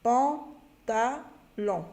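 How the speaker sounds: pantalon drawn out very slowly, syllable by syllable; slow blending. Shown as a demonstration. Teacher blending syllables into pantalon for pupils, very slowly. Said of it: "blending syllables into word".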